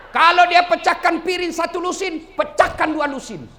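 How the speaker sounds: loud, high full-force sermon; fiery preaching. Acted. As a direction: rouse the crowd at full force. Ceramah berapi-api. (ceramah berapi-api dan lantang)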